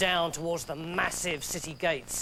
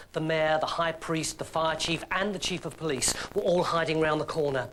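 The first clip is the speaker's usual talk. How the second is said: plain reading voice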